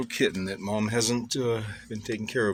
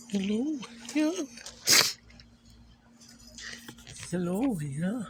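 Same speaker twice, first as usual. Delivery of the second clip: pet talk; 10 semitones higher than usual. Real, not acted. Greeting cats in high voice.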